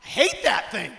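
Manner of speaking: high, loud startled yell at a snake; startled snake shout. Retold or imitated. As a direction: jump back and yell in disgust. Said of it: "You usually go".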